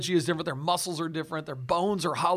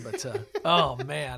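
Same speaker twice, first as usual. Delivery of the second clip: ordinary talk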